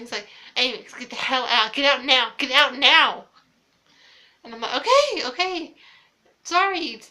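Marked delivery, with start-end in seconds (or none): 0.6-3.2 loud, forceful get out now; angry shouted command; furious yelling. Retold or imitated. Brother yelling her out again. 4.8-7.0 much higher, loud startled okay; startled high-pitched okay, okay; flustered apology. Retold or imitated. Flustered retreat after being yelled at.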